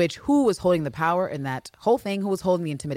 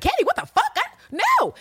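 mock shocked Villanelle. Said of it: "She's like".

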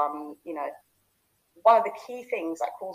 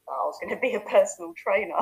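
normal talking voice

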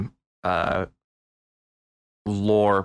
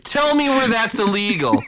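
defiant dude voice; 12 semitones higher, 4 dB louder than usual. Retold or imitated. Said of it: "And he's like".

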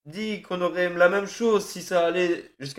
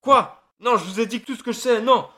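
fast, higher, panicked cry of denial; panicked protest; 4 semitones higher, 4 dB louder than usual. Acted. Prisoner begs his torturer in panic, fast and loud. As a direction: blurt it out in terror, words tumbling.

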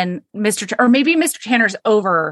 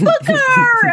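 high, delighted call of a name; overjoyed cry; 4 dB louder than usual. Retold or imitated. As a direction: squeal his name with joy. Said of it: "she is over the moon".

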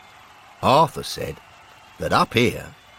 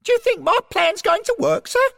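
very high, squeaky character voice; 4 dB louder than usual. A narrator's reading. Derek asking his superior about the plan, high-voiced. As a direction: pipe it up far above your own pitch. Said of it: "in his high voice".

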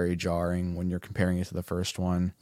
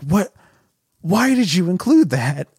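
baffled disbelief; 9 semitones higher, 4 dB louder than usual. Retold or imitated. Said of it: "And I'm like".

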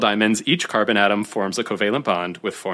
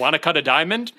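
high, pushy sales pitch with a laugh; used car salesman voice; 7 semitones higher than usual. Acted. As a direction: hawk it like a slick car dealer. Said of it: "It's my used car salesman voice".

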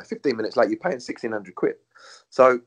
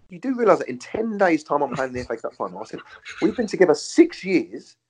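higher, pleading retold complaint to his ex; replaying his own hurt protest, pitched up; indignant retold plea; 8 semitones higher, 3 dB louder than usual.

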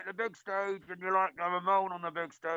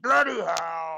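a loud exasperated fan bellowing from the terraces; mock fan outburst; 3 semitones lower, 7 dB louder, much slower than usual. Co-host playing a fed-up fan, loud mock outburst. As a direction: blurt it out loud in mock despair.

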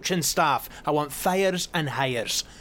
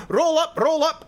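high, loud fairground call; carnival barker; 10 semitones higher, 4 dB louder than usual. Acted. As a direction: hawk it like a showman. Mock carnival barker announcing.

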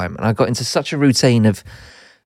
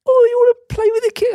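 put-on much higher, sing-song baby voice; baby talk; faster than usual. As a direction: coo it like talking to a baby. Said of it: "talk to him like".

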